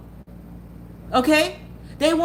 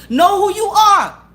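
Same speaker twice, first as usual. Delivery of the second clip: louder, higher, slow and forceful; loud slow command; 7 semitones higher, 8 dB louder, slower than usual. Real, not acted. To viewers crying over exes, loud slow command. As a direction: drive each word home like a coach. Heated pep talk.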